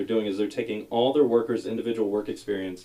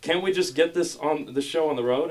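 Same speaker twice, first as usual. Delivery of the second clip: exasperated rant; 6 semitones higher than usual. Retold or imitated. Ranting at the intercom.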